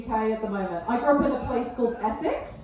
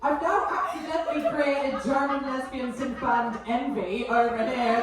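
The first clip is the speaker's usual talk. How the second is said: ordinary delivery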